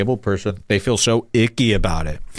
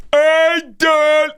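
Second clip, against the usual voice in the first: much higher, strained put-on voice of a comic; high louder strained impersonation; Michael Lehrer impression; 4 dB louder than usual. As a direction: mimic him straining out the words. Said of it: "this is exactly how he sounds".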